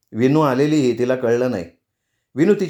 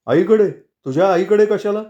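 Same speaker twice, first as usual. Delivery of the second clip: higher, louder indignant question; incredulous echo; 7 semitones higher, 3 dB louder than usual. A narrator's reading. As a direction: throw it back in disbelief. Kedar echoes her answer, challenging it.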